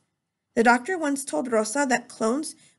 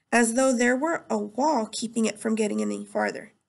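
ordinary delivery

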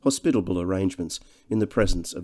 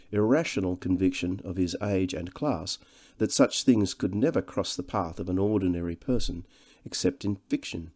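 plain reading voice

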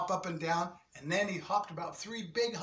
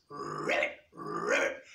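much lower, croaked ribbit; deep frog croak; slower than usual. Acted. Froggy answering the kids, very low croak.

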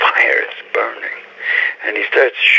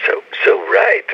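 ordinary talk

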